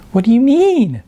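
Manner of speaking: high mocking echo of her words; teasing mimicry. A narrator's reading. Jasmine teasing her friend by mimicking, high and mocking. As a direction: parrot her back, teasing. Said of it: "Jasmine teasingly mimicked her".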